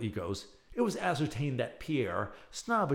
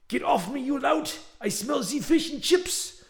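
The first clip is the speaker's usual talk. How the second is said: louder and higher indignant French protest; indignant outburst; 13 semitones higher, 6 dB louder than usual. Acted. Frenchman Pierre protesting at the British captor, indignant. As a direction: shove off the intruder with wounded pride. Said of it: "then dignity".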